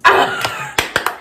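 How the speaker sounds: piercing high squeal; excited squeal. Real, not acted. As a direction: let out a giddy shriek. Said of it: "Let me stop doing that".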